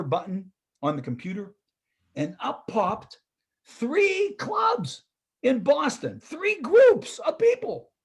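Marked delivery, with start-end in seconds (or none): 3.6-7.9 much higher, amazed, stretching it out; excited surprise. Retold or imitated. Speaker telling the audience about the search, amazed. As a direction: sell the delight of finding three clubs.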